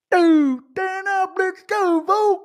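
extremely high, drawn-out garbled voice; Justin Gaethje. Acted. Fighter answering at a press conference, squealed mock trash talk. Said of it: "Gaethje versus Hooker press conference trash talk impression".

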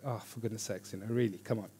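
soft, worn-out exasperation; mock fed-up grumble under the breath; exasperated. Friend drained by a negative person, exasperated. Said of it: "it's just exhausting".

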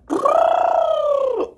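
very high, loud elephant blast; elephant's trumpeting blast. A narrator's reading. Elephant takes its turn to scare her, trumpeting. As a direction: blast it out high after a big breath. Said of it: "He sucked in the air and".

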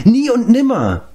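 high-pitched cry of refusal; vehement protest. A narrator's reading. Giant rejecting any wish for giant size, protesting. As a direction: protest sharply, pitch leaping up. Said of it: "protestierte Glev".

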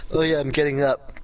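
ordinary talk

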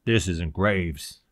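low, slow, firm correction; snapped. A narrator's reading. Cloud corrects the prisoner over the speaker, snapping. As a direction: cut him short with authority. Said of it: "the speaker snapped".

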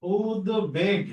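উদ্বেগ very slow, ud-beg, b clear; sounded b. Shown as a demonstration. Teacher answering how it is pronounced, slow with b sounded. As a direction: stretch উদ্বেগ and keep its b. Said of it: "তাহলে এটা উচ্চারণ কি হবে?".

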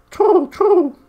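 very high, loud, squeaky owl hoot throughout. A narrator's reading. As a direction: redo it as a full-on shrill owl. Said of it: "whirred the owl".

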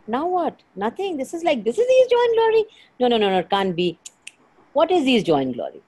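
high, loud, mock-incredulous outburst; acted-out client reaction. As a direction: play the baffled client protesting in disbelief. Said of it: "You're like".

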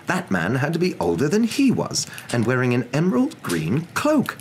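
high-pitched indignant outrage; enraged. A narrator's reading. Narrator voicing Mister Dursley's thoughts about a cloaked older man, enraged. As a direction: build from indignant disbelief to a high, scandalised exclamation. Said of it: "Mister Dursley was enraged".

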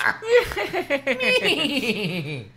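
high, loud put-on giggle; exaggerated squeaky tittering; hammed-up bratty giggle; giggling. A narrator's reading. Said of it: "He was still giggling".

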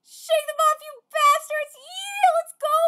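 loud high battle cry; rallying shout. Acted. Pirate rallying the crew to escape, shouting. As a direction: fire up the crew.

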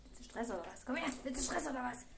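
lightly done mock tough guy; belligerent drunk. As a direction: play at picking a fight. Mock picking fight with dog.

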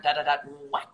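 ordinary talk, nothing marked

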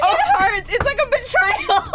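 laughing lament. Real, not acted. Player says lying to friends hurts, high laughing wail. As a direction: cry it out while laughing helplessly.

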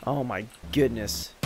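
ordinary talk

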